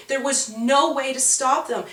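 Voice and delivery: ordinary delivery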